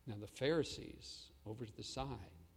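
ordinary delivery

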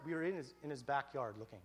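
ordinary delivery

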